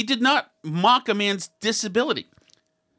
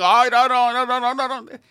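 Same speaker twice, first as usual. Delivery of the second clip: mocking Trump; 3 semitones higher than usual. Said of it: "the way Trump was holding himself, saying".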